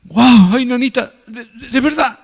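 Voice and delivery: hammed-up humble astonishment; humble surprise. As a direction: play it amazed and a bit flustered. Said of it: "el humilde dice".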